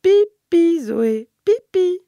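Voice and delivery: the whole family urging Zoé to pee, loud slow sing-song; parents coaxing in chorus. Acted. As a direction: chant "pipi" to cheer the toddler on. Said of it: "s'y mettent tous en chœur".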